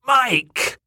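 high, loud reproachful cry; squawked call. Acted. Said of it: "Sunderland squawked again".

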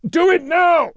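full-force, high-pitched yell; shouted order. Acted. Commander demanding torpedoes be fired, furious-force shouted order. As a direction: bellow it as an urgent command. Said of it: "Sanguinius shouted".

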